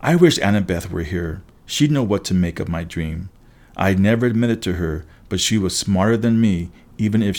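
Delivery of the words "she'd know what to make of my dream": ordinary delivery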